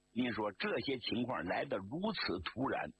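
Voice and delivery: usual speaking voice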